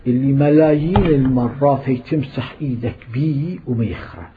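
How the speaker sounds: ordinary talk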